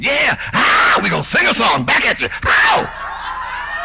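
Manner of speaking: loud wild shouting singer on stage; imitating a drugged-out singer. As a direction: yell like a spaced-out pop star. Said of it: "I'll just do a little imitation of them".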